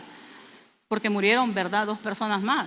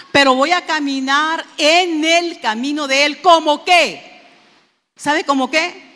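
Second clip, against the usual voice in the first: loud preaching; 4 semitones higher, 8 dB louder, slower than usual. Acted. Preacher exhorting with force.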